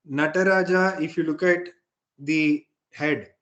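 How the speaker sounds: ordinary talk, nothing marked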